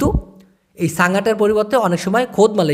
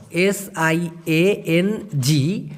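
slow, softer letter-by-letter spelling; 3 dB quieter, much slower than usual. Shown as a demonstration. Teacher spelling the word for students, slow and deliberate. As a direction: spell it out slowly, one letter at a time. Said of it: "একটা শব্দ আছে সিয়াং".

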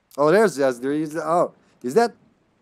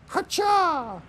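long, high-pitched "hacha" throw cry; mock warrior yelp, drawn out high; high battle cry; 3 dB louder than usual.

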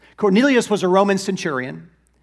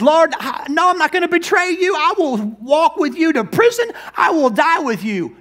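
eager protest; 9 semitones higher than usual. Acted. Peter voiced protesting to Jesus.

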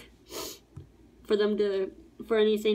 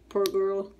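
usual speaking voice

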